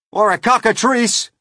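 high, loud yell; alarmed yell. A narrator's reading. Police chief guessing at the monster, alarmed yell. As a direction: yell out another monster name. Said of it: "yelled the chief of police".

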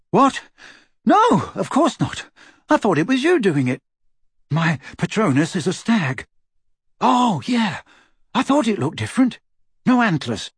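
put-on high, quick teen boy; Ron voice. A narrator's reading. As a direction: blurt it out, surprised.

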